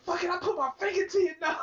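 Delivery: high, quick mock girlfriend voice; imitating girlfriend. Retelling his girlfriend's road rage, high mock outburst. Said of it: "She like".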